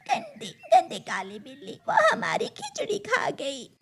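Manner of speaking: soft, laughing fake sob; mock crying crow. Crow faking tears to the chicks, mock sob. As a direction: pretend to cry over the cat.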